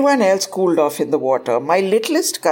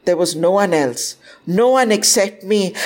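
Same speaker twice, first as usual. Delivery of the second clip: usual speaking voice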